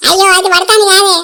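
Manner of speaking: loud, high mock lament after the crash; crying loud wail. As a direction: wail over the fall. (ఏడుపుతో గట్టిగా గోల)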